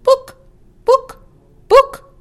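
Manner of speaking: very high loud clucks; clear clucking hen. Acted. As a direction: cluck each book clearly. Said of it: "clucked the hen quite clearly".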